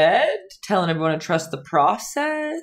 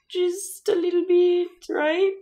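cutesy high voice; 13 semitones higher than usual. Acted. Coaching a painting step.

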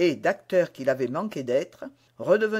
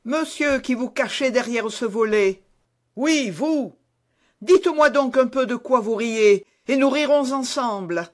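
loud, high, provoking taunt; a hot-headed youth calling out a mocker; angry, loud and higher all through; furious challenge; 7 semitones higher, 6 dB louder than usual. A narrator's reading. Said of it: "s'écria-t-il".

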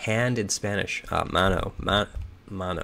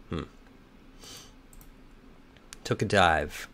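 normal talking voice

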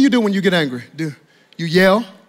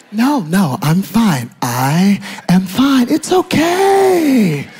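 slow, stretched-out denial building louder and higher; hammed-up "everything is okay"; stuffer's forced calm; 4 semitones higher, 6 dB louder, much slower than usual. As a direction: insist through swelling tension. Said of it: "We just calmly say".

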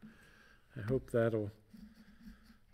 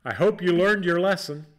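louder, fast scolding mom; mom lecture; 6 semitones higher, 9 dB louder, faster than usual. Retold or imitated. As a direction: scold like a stern mother. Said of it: "here's the one I'm thinking of".

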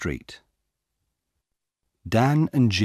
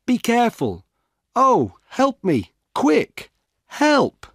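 high, loud cries for help, building to a scream; shouting for help; 5 dB louder than usual. A narrator's reading. Sue shouts for help as the jogger hits her, panicked. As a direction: yell in panic as she's knocked down. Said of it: "Then Sue shouts".

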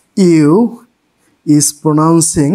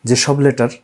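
ordinary talk, nothing marked

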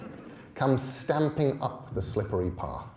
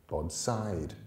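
ordinary delivery, nothing marked

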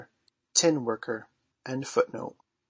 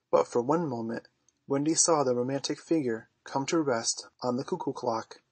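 usual speaking voice

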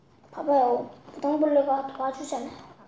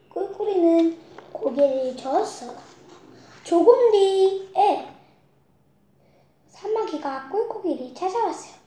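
ordinary delivery, nothing marked

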